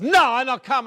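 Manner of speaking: a stubborn Italian mama saying no; mother's Italian accent. Retold or imitated. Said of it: "she's got the accent".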